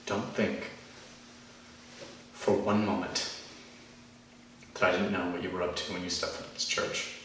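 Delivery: low, hushed accusation; quiet confrontation. Acted. Speaker letting intruder know they were seen through, quiet. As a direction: drop well down in volume and measure it out.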